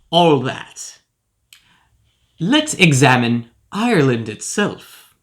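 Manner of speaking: usual speaking voice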